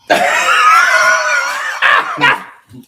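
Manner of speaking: high, loud burst of laughter; shrieking laugh. Real, not acted. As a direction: explode into a howling laugh. Cracks up at the punchline.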